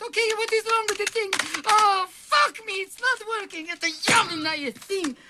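very high, panicky squeal; panicked high voice. Acted. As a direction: voice shoots up in panic. Doctor panics under pressure.

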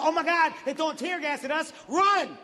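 high-pitched panicked warning; retold yell. Protester warning marchers of tear gas, mock yelling. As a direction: imitate someone yelling an alarm to a crowd. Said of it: "somebody yelled out".

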